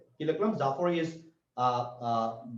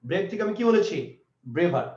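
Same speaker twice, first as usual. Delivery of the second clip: ordinary talk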